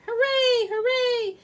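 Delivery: high loud hooray; cheer. A narrator's reading. Elves welcoming Santa home, loud cheer. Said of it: "elves sang out a cheer".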